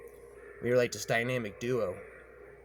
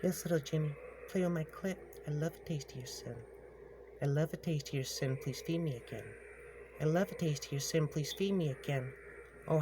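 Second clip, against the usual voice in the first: higher, softer hungry witch; 5 semitones higher, 4 dB quieter than usual. Retold or imitated. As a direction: croon it hungrily, high and soft. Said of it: "She was whispering to me".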